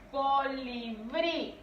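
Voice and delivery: colibrí drawn out slowly, leaning on the last syllable "brí"; stress on final syllable. Shown as a demonstration. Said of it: "es donde recae la mayor fuerza". (colibrí lento, alargando y acentuando "brí")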